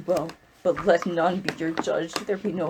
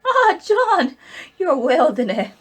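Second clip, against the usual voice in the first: much higher, loud surprised cry; startled greeting; 9 semitones higher, 9 dB louder, much slower than usual. Acted. Old woman seeing John in the cell, surprised loud cry. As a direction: burst out at the sight of him.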